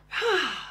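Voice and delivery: long breathy exhale; relaxing sigh. Shown as a demonstration. Therapist showing parents how to slow down, relaxed sigh. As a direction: let out a slow calming sigh.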